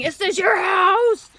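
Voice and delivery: high, loud, slow goofy taunt; silly clown voice. Retold or imitated. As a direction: ham it up like a clown.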